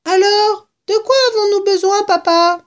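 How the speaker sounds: put-on high, loud child's voice; little fox cub. A narrator's reading. Cub asking his papa, high piping question. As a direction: ask it like an eager small child.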